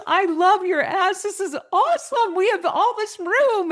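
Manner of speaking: very high, loud gushing delight; excited. Retold or imitated. Seatmate delighted to get all this room, wildly excited. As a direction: gush at full pitch, stretching the words. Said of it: "she was so excited".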